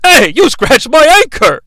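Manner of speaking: high-pitched put-on complaint; lightly voiced outraged character; mock indignant character. Acted complaint from Crosshair.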